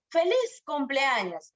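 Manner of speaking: slow, lilting exclamation; emotive greeting. Shown as a demonstration. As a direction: demonstrate a happy congratulation. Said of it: "Estoy expresando emociones".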